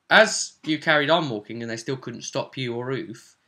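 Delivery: ordinary talk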